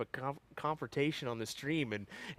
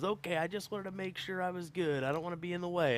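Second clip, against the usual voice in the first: higher-pitched, apologetic quote; lightly voiced eager-to-please helper; imitating the chalker; 6 semitones higher than usual. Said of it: "And she goes".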